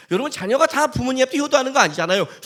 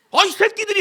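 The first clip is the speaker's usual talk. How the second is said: higher, angry curse at the others; angry drunk snap; 9 semitones higher than usual. Retold or imitated. Drunk friend turning on the table, angry snap. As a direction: snap at them in drunken anger. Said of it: "막 더 막 화를 내는 거야".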